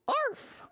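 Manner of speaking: very high "arf"; sharp little imitated dog bark. Mother dog showing George how dogs go, high bark. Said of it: "dogs go".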